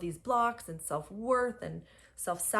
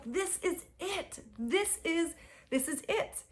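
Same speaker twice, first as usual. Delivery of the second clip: awed realization; 10 semitones higher than usual. Retold or imitated. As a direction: marvel at the moment. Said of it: "I was like".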